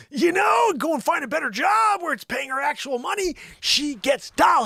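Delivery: high, loud hammed-up rant; mock tirade. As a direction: shout her down in a high mock fury. Mock tirade putting Joanne down.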